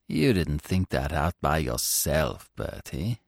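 hushed with wonder. A narrator's reading. Bingo, awed by Bertie's plan, in a hushed voice. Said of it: "in a hushed sort of voice".

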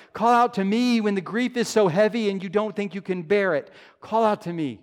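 loud, high, repeated pulpit refrain; impassioned repeated appeal; preaching, building. Acted. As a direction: rise in fervour with each call.

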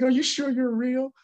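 high, slow imitation of wonder; excited homeless man. Homeless man doubting his new friend is real, imitated excitedly. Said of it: "like, so excited".

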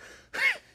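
squeaky high, loud giggle. Acted. Ruff giggling as the vacuum tickles him.

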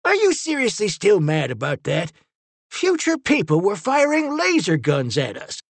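high, exasperated protest; indignant cry. A narrator's reading. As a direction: cry out in self-defence. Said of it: "Finn cried".

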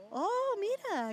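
high, drawn-out amazed reaction; slow, swooping "oh" of real wonder; pleasant surprise. Real, not acted. Reacciona al significado del nombre. (sorpresa real, aguda y alargada)

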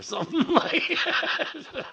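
real laugh bursting through the words, slowing them; breaking into laughter. Real, not acted. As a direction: crack up as you finish the phrase. Amused by the album cover art.

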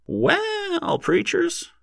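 put-on very high, thin old-timer's voice; tinder-dry, impatient heckler; impatient demand. A narrator's reading. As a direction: needle them in a high, dry falsetto. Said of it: "demanded an impatient voice".

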